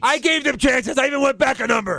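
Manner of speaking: high-pitched, hurried excuse; mock defensive referee.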